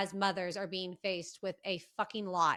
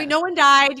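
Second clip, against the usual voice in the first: exclaimed, higher and much louder; loud, raised-pitch comic punchline; loud mock triumph; 5 semitones higher, 13 dB louder than usual. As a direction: burst out with the retold good news. Exclaims friend's morning text, joking.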